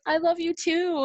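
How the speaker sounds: high, slow tender confession; tender reply. Retold or imitated. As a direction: say it back, moved. Retelling saying it back.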